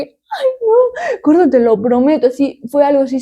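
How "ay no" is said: high, tearful-sounding wail of revulsion; whiny, pitched-up mock distress; mock disgusted whine. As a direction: re-enact desperately wanting it out. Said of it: "quería sacarlo así como que".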